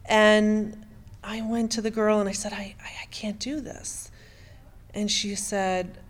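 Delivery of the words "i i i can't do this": faltering whisper, stammering on I; whispered refusal. Retold or imitated. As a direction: breathe the words out, voiceless and shaken. Retold refusal to coworker.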